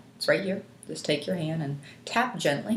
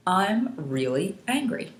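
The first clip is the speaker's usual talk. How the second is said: slow, measured statement, word by word; stating the problem; slower than usual. Shown as a demonstration. Coach leading tapping on the eyebrow point, slow deliberate statement. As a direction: state it deliberately for others to echo. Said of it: "We're just gonna say that statement".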